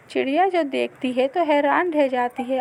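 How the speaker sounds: ordinary delivery, nothing marked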